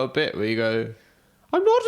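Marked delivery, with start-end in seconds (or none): 1.5-1.8 very high, loud mock child voice; Victorian child. Co-host imitating friend's comedy bit, Victorian child voice. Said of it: "Victorian child voice".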